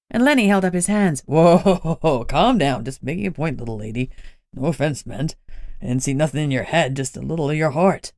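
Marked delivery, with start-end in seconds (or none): 1.3-8.1 put-on curious, easy-going old man explaining; a wily older man, unbothered; lower old illusionist. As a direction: lower voice, relaxed and chatty. Lenny placates Bunny.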